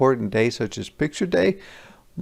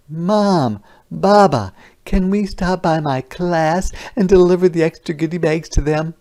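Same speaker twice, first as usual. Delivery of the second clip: lightly voiced child, higher and eager; pleading child voice, higher pitched; 7 semitones higher, 5 dB louder than usual. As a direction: ask like an excited little girl. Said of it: "child asking parents eagerly".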